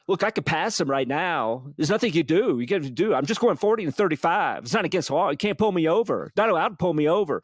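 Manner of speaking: a cocky, hammered driver arguing his case; imitating Jay. Drunk friend bragging about passing the cop, mock bravado. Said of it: "pretty good imitation".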